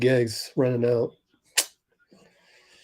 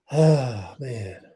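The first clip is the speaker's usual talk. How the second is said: normal talking voice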